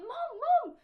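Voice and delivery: high loud call. Retold or imitated. Child calling to mum through the kitchen window. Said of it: "I went".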